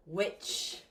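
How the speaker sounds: usual speaking voice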